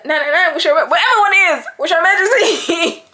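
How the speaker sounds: lightly put-on American operator accent; the call handler in a hint of an American accent; mock American accent. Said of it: "Not the American accent".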